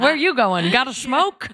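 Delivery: high, hammed-up street character; old New York catcaller. Joking about old New York, playful catcalling. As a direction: call out like a comic old-time New Yorker. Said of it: "Miss the old days of New York".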